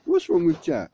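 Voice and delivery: lightly acted puzzled sister; acted concerned question. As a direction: ask in sudden concern, pitch up. Sister asks on arriving home.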